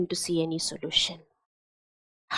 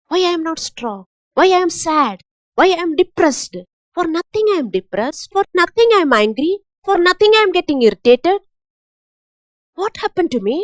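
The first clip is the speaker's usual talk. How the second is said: higher and louder, rushing complaints; mock frustrated outburst; 6 semitones higher, 4 dB louder, faster than usual. Preacher acting out a depressed, irritable believer, higher and louder. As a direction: vent like someone irritated for no reason.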